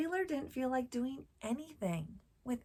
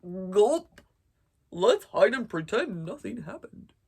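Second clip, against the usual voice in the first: loud, gulping ostrich voice; gulp, then blurt it out like a scared ostrich; nervous ostrich; 3 semitones lower, 12 dB louder than usual. Acted. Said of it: "The ostrich".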